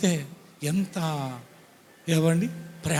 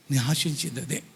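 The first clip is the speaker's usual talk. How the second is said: ordinary talk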